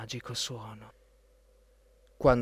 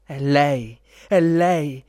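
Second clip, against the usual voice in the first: loud, thrilled prince's exclamation; emotional cry; 8 dB louder, much slower than usual. A narrator's reading. Prince recognises his beloved, loud excited cry. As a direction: call out with moved excitement. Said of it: "gridò emozionato il principe".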